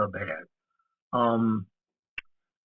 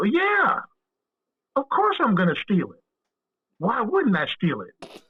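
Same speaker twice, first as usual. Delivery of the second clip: incredulous insistence; 8 semitones higher, slower than usual. Retold or imitated. Retold insistent answer.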